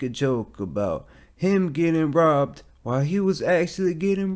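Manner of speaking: Charlemagne impression. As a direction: announce it like a morning show roast. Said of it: "That's my impression of Charlemagne".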